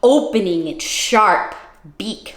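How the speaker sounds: ordinary delivery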